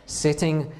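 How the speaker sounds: ordinary talk, nothing marked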